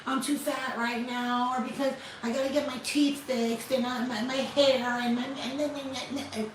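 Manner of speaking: lightly imitating a self-doubting woman's excuses; mock whiny excuses. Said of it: "No more like".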